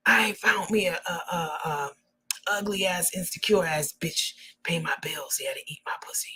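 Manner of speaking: a touch of a whiny whisper; mock her scheming, half-whispered; lightly voiced scheming woman; whining Summer. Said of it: "she said".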